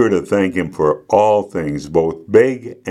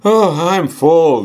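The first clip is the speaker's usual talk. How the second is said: high, drawn-out declaration of being stuffed; emphatic declaration; 9 semitones higher, 4 dB louder, slower than usual. A narrator's reading. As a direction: declare it high and slow, as a child at table. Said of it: "declared Cassie".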